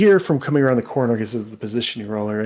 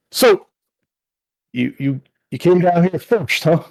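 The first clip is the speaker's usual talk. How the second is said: louder, higher, probing challenge; suspicious elf taunt; 5 semitones higher, 5 dB louder than usual. Acted. Bulky elf questioning the party who got there first. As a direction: needle them, suspicious.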